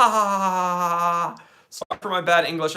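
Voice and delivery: usual speaking voice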